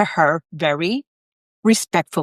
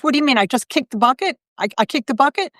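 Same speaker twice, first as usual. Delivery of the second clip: surprised; 7 semitones higher than usual. Retold or imitated. Ghost realizes she is dead.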